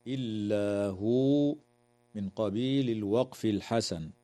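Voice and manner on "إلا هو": slow recitation, هو stopped with a silent waw; stop with two-count madd. Shown as a demonstration. Teacher showing where to stop the verse, slow recitation. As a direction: end on هو and hold it only two counts. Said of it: "ويجوز الوقف على قوله تعالى".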